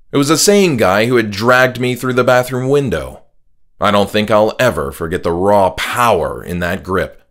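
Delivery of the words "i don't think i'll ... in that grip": ordinary delivery, nothing marked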